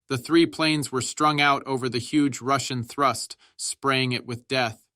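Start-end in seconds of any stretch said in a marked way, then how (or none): none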